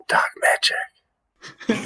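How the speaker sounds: slow, voiceless whisper. Acted. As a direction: whisper it slowly into a listener's ear. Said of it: "I just whisper".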